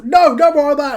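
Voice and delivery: high, loud official's shout; referee yelling. Acted. Referee stopping the fire stunt, high urgent yell. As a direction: shout them down like a referee stepping in. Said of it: "the referee's yelling".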